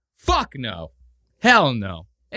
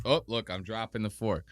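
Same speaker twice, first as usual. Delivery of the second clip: ordinary delivery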